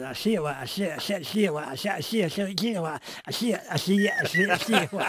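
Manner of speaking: lightly sing-song, rising bit by bit; special message for babies. Shown as a demonstration. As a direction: chant it to a tiny child, building. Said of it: "I used to have a special message for them".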